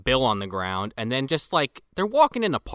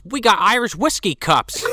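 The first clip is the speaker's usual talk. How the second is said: usual speaking voice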